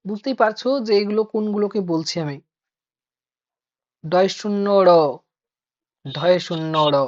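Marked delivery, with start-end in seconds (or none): 4.0-4.9 ড় in ডয় শূন্য র tapped with a curled tongue; demo of flap. Shown as a demonstration. Teacher demonstrating the flap sound. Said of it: "এগুলো আবার উচ্চারণ করতে অসুবিধা". 6.0-7.0 ঢ় in ঢয় শূন্য র as a breathy tapped flap; demo of aspirated flap. Shown as a demonstration. Teacher demonstrating the breathy flap. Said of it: "এগুলো আবার উচ্চারণ করতে অসুবিধা".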